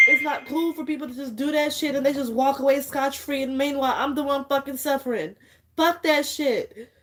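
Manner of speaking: high-pitched, upset rant; distraught venting. Real, not acted. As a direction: plead your case at the end of your rope. Upset rant, fed up, near tears.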